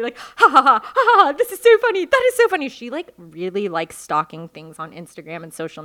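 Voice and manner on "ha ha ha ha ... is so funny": high, loud mock hysterics; imitating hysterical laughter. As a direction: laugh through the words, hammed-up and shrieking. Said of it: "like, laughing hysterically".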